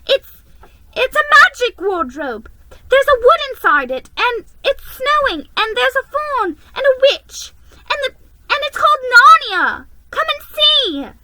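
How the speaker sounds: high, loud excited gushing; an excited girl telling of Narnia; breathless excitement. A narrator's reading. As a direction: tumble it out eagerly. Lucy excited.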